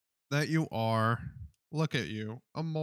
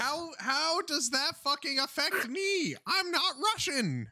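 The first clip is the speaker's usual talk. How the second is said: much higher, rising into a shout; mock rage; 13 semitones higher than usual. Streamer berating a friend's cat on call, hammed-up screaming rage. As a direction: scream insults at the cat in comic fury.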